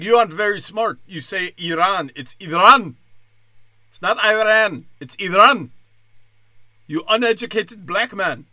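scolding reporter in a hammed-up Iranian accent; punchy caricatured Iranian accent; mock Iranian accent. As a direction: correct them indignantly in a mock Iranian accent. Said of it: "an Iranian reporter before the game".

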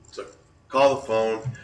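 ordinary delivery

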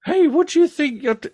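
loud, high, quick shouted protest; indignant shout. Acted. Girl startled by a grab at her handbag, shouting. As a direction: shout it fast and high, breaking off mid-word.

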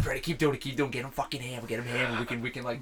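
soft, low scheming; quiet, low urging of a brother; conspiratorial. Retold or imitated. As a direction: hush it like plotting mischief. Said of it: "I'm like".

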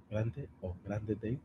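ordinary talk

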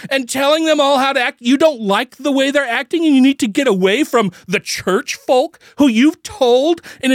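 a shouted inner monologue at the pastor's wife; internal screaming rant. Retold or imitated. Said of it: "screaming internally".

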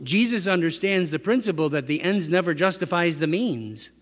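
normal talking voice

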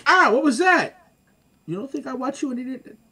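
high, loud mock mother catching him out; mock mom. Imitating his mom who watches the show.

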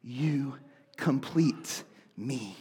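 low, slow, hushed romantic movie quote; Tom Cruise's character. Retold or imitated. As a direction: draw it out softly, pausing between words. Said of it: "looks at his wife and says".

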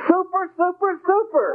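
very high, cracking mock-cheer with a laugh; gushing super. Lecturer mimics an overjoyed person answering, squealing with laughter. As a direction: squeal it like the unbearable happy one.

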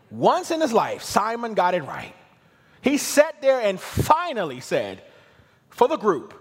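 loud, high bursts of amazed preaching; fired-up preaching. Real, not acted. Preacher marvels that Simon finally got it right, fired-up preaching. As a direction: preach it up, punching the key phrases. Preacher builds to full force.

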